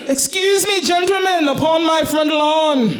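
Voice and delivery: orate like a pompous gentleman from a window; lofty mock speech to the cops on her lawn; grand address. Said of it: "I address the gentleman on my front lawn".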